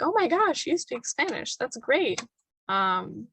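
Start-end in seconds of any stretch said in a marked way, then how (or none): 0.0-2.2 high, excited mock praise that settles down; delighted local. Locals in Peru praise student's Spanish, high excited imitation. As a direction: squeal it high at first like an impressed local. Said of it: "immediately they were like".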